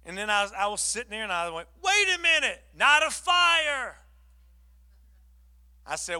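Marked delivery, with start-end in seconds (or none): 1.8-3.9 much higher, louder, slow stretched "night of fire"; sudden realisation. Retold or imitated. Pastor realising the answer, high and drawn out. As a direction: draw out the idea as it dawns.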